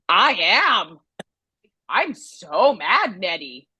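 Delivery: drawn-out mock fury, pitch swooping; retold anger. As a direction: act out being mad, stretching the words slowly. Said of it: "You look like you're gonna flip the fucking table".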